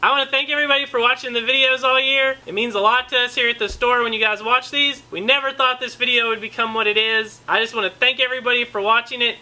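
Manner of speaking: store sign-off. Acted. Thank-you sign-off.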